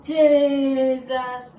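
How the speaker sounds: loud, exasperated Jesus; loud exclamation. Acted. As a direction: shout Jesus in shock. Mom shocked at spilled juice.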